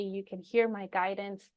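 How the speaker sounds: ordinary delivery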